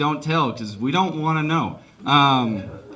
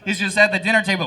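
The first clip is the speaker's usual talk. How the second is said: ordinary talk, nothing marked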